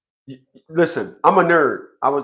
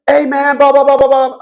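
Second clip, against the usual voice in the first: high-pitched, louder imitation of a ranting client; mock client rant; 8 semitones higher, 4 dB louder than usual. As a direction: parody someone going on and on. Retold client's agitated rant.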